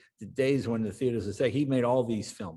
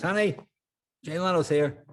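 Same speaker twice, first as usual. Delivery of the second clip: higher call into the next room; 6 semitones higher than usual. Retold or imitated. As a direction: call out to someone down the hall. Husband calls to wife in bedroom.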